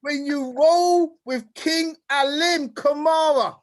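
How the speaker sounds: slow, drawn out, each word spaced; slowly. Shown as a demonstration. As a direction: spell it out slowly for the crowd. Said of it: "I'm gonna say this slowly".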